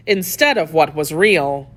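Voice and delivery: usual speaking voice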